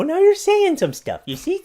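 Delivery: excited cheer. Real, not acted. Dad cheering his babbling baby, high and loud.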